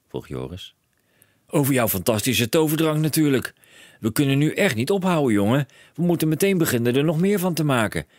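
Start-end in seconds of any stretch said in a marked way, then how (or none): 1.5-8.1 high, loud, breathless with excitement; a dad who can't stop; eager father. A narrator's reading. Father urging his son at breakfast, high eager voice.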